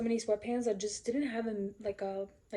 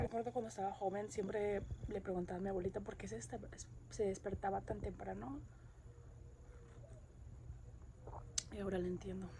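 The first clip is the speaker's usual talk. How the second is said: quiet reflective voiceover narration; hushed, wistful morning narration; soft voiceover; 11 dB quieter than usual. Acted.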